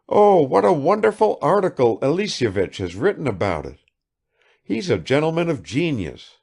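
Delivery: high, rapid-fire chatter of a vain lady; affected negligence. A narrator's reading. Kukshina showers Bazarov with questions, high and affected. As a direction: pour out questions without waiting.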